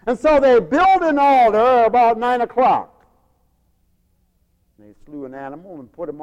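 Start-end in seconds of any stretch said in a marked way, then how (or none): 0.1-2.9 loud, high preacher's proclamation; full-force preaching. Acted. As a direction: belt it out to the congregation.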